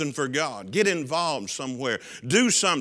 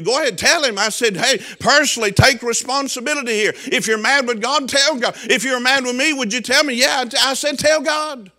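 higher, louder urging; preaching at full force; 6 semitones higher, 4 dB louder than usual. Retold or imitated. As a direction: press the grieving man to pray.

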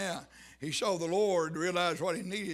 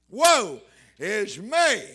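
loud, drawn-out cry of woe; exclaimed cry; 10 dB louder, much slower than usual. Retold or imitated. Preacher voices prophet confessing before God, exclaimed cry. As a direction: stretch each word out and cry it loudly. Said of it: "Explanation mark".

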